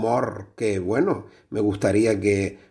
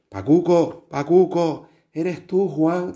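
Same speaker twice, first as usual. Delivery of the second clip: raised, higher voice calling a friend's name; calling out from heaven; 6 semitones higher, slower than usual. Acted. Dead friend calling his old mate from heaven, higher calling voice. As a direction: call up to him as if down a long line.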